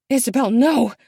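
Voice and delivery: loud, high cry of no. A narrator's reading. Mother crying out to her daughter, loud. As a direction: shout to stop her.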